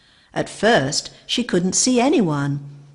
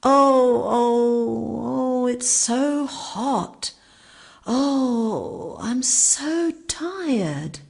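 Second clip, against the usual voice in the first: moaning; 6 semitones higher, much slower than usual. A narrator's reading. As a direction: moan wearily in the heat. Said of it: "moaning coming from the grass".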